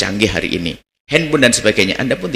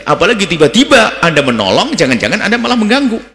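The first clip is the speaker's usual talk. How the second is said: raised, loud sermon, voice climbing high; fiery preaching; 8 semitones higher, 3 dB louder than usual. Acted. Preacher rousing mothers and children, loud fiery sermon. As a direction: preach it hard and loud to the parents.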